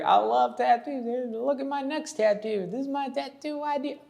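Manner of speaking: high-pitched mock gushing client; overeager tattoo fan. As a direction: gush like an uncool fan pitching an idea. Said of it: "play it a little cooler".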